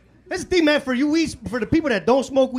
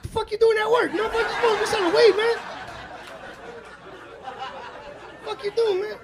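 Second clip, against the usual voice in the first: indignant yell at dealer; 13 semitones higher than usual. Retold or imitated. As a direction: squawk at the dealer for getting a job. Mad at dealer with job.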